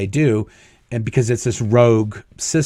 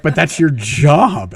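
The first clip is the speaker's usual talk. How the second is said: incredulous; 5 semitones higher, 5 dB louder, slower than usual. Retold or imitated. Boss baffled by the firing.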